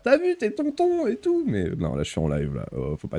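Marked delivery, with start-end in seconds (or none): 0.0-1.5 high, drawn-out mock dad voice; mimicked dad, pitched way up; imitating dad. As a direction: do an excited dad on the phone, squeaky and stretched. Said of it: "c'est mon père qui m'appelle pour me dire".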